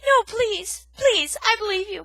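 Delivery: high, loud pleading; frightened cry. Acted. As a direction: cry out in panic. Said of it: "Poppy cried".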